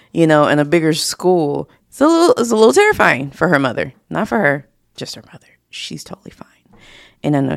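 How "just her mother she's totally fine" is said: a confiding whisper about herself; whispered aside. Real, not acted. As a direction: whisper it as a private joke. Aside about mother's worry.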